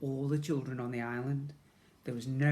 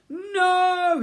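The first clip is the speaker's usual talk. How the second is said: high, loud cry of dismay; anguished cry; 10 dB louder than usual. A narrator's reading. Ned seeing the slimeline vanish, crying out loudly. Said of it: "he cried".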